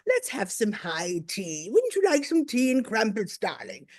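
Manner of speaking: mock posh English tea-time voice; posh English accent. Americans' idea of English accents, mock posh. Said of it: "all English accents are very, very posh".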